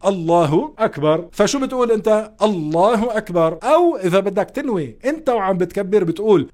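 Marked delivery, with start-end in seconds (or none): none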